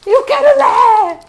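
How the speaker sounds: high, loud, slow insistent cry; shouting a blunt demand at the teacher; bossy demand. Retold or imitated. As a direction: demand it like a stubborn kid. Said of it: "Aí você fala assim".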